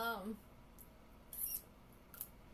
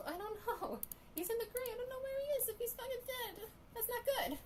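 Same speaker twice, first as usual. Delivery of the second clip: high anxious voice; 14 semitones higher than usual. Real, not acted. High-pitched worried rant.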